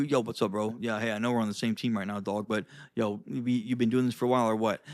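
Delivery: low, fast, offhand bro greeting. Shown as a demonstration. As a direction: come off low and a bit threatening. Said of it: "Do you see how, like, my tone".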